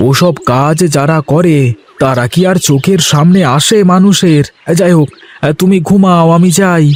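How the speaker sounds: a cheerful farmhand talking to himself under the sun; young farmer Ganesh. Acted. Ganesh protests, leaves.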